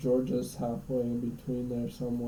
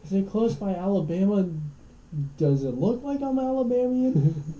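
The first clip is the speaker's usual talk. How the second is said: higher, louder attempt at a southern accent; hammed-up mock southern indignation; 7 semitones higher, 5 dB louder than usual. Annoyed local answering a geography question, hammed-up southern accent. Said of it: "can't even do a southern accent".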